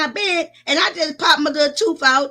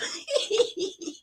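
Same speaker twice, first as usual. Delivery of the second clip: burst of laughter; 7 semitones higher, 8 dB quieter than usual. Real, not acted. Laughs at own setup.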